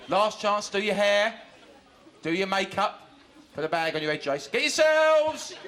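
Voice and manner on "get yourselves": high, loud and slow, stretched out; drawn-out rallying call. Acted. Warm-up man rallying the audience, loud slow call. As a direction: call it out long to wake the crowd.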